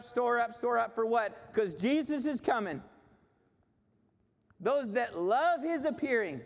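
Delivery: high-pitched, urgent sermon voice; rally the congregation with each phrase; fervent preaching. Real, not acted. Preacher urging the congregation to store up, fervent preaching.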